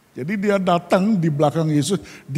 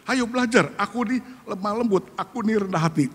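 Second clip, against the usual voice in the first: soft, higher inviting voice; gentle divine invitation; 6 semitones higher, 5 dB quieter than usual. Acted. Preacher voices the Lord inviting the listener, soft and higher. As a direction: coax them in like a gentle Lord.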